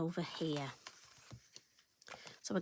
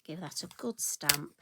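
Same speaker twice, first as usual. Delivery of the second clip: ordinary talk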